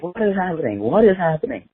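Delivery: high, baffled repeated question; bewildered stranger. Retold or imitated. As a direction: ask it twice like you can't believe it. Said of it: "He's like".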